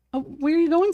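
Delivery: ordinary talk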